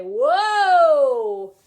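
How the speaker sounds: loud, long pirate bellow; pirate chest voice. Shown as a demonstration. Teacher cueing students to copy, pirate holler. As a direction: belt it from the chest, swooping down. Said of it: "like, like a pirate".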